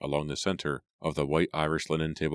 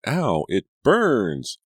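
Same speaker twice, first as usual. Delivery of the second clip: very slow, loud and higher cry of pain; 7 semitones higher, 7 dB louder, much slower than usual. A narrator's reading. Scalded daughter dabbing her dress, slow loud cry. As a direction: drag out the burning pain.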